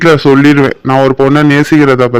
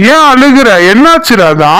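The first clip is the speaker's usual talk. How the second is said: alarmed concern; 7 semitones higher than usual. Acted. Alarmed at her crying.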